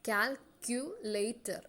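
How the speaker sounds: "Calculator" stretched very slowly, each syllable apart; drawn-out syllables. Shown as a demonstration. Teacher asking students to listen, very slow syllables. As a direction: pull "Calculator" apart beat by beat. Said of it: "നിങ്ങളൊന്ന് ശ്രദ്ധിച്ചേ".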